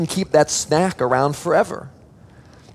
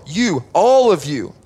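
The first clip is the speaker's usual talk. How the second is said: slow, punching all; slow inclusive emphasis; emphatic all of you; 3 semitones higher than usual. Shown as a demonstration. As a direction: point at everyone, stressing all. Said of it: "You are the salt".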